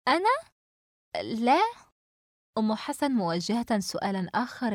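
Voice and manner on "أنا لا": high, startled little question, then a short no; flustered. A narrator's reading. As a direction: caught off guard and flustered, pitch jumping up. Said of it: "سمر بارتباك".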